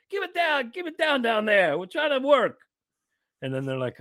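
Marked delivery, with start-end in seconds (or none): 0.1-2.6 high mock shout from upstairs; neighbors yelling up. Upstairs neighbors yell down at the hosts, high shout. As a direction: yell it down from the floor above. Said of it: "and then you hear".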